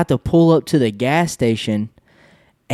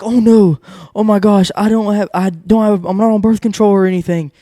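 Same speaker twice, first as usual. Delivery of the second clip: imitating her panic; 7 semitones higher than usual. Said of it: "she's, like, freaking out".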